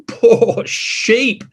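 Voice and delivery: ordinary delivery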